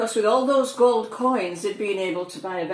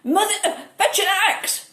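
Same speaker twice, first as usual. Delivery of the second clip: called out much higher and louder; shouting; 12 semitones higher, 5 dB louder than usual. A narrator's reading. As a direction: yell to mother down the garden in a panic. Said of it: "shouted Jack".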